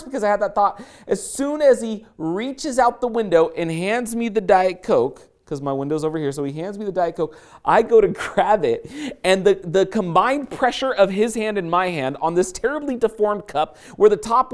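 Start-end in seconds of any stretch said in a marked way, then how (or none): none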